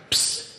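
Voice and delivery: "ps" as a soft voiceless puff, lips closed then released; just breathe out a quick soft "ps"; demonstrating the sound. Shown as a demonstration. Teacher models the "ps" cluster for the student, voiceless puff.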